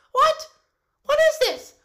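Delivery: loud, high disbelief; startled, loud questioning; shocked outburst. Acted. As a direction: exclaim in alarm, pitched high. Nutmeg shocked by the letter.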